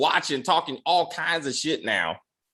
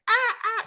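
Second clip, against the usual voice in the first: small, very high "ah, ah"; high-pitched yelps, kept small; little mock cries. Host mimicking how he'd react, little high mock cries. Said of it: "just a little bit".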